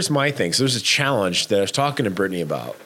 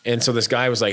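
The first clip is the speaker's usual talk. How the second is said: normal talking voice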